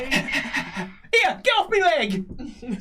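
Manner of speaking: high-pitched, drawn-out indignant halfling. Acted. Halfling shooing a dog off his leg, high protest. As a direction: protest in a high little character voice. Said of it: "says the halfling".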